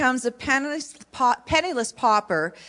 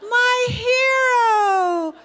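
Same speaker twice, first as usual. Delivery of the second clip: very high, slow, swooning cry; swooning heroine; 12 semitones higher, 4 dB louder, much slower than usual. Acted. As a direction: gush with melodramatic relief. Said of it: "So here we go".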